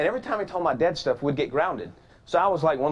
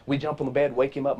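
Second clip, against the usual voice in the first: ordinary delivery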